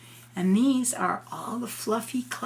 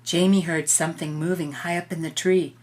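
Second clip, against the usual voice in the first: ordinary delivery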